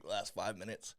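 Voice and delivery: usual speaking voice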